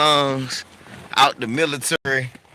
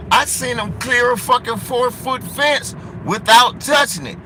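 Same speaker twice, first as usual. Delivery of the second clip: high, emphatic retold astonishment; amazed disbelief; 8 semitones higher than usual.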